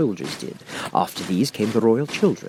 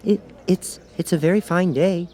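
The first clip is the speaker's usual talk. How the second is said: timid Rabbit; 10 semitones higher than usual. A narrator's reading. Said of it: "said a timid voice".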